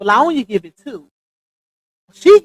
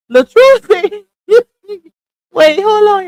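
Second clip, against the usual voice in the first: high and louder, cracking up; laughing; 8 semitones higher, 8 dB louder than usual. Real, not acted. Reading LaTruth's message, breaking into laughter. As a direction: lose it mid-sentence.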